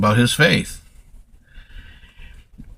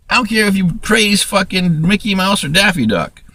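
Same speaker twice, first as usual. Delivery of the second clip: ordinary talk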